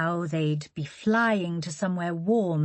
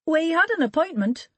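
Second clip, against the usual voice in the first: much higher, louder salesman voice; smooth and convincing; Charlie's voice; 11 semitones higher, 4 dB louder than usual. Acted. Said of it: "Charlie slid in before Holly".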